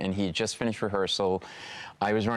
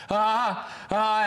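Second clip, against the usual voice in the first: frozen stammer; 10 semitones higher than usual. Retold or imitated. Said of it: "I'm like".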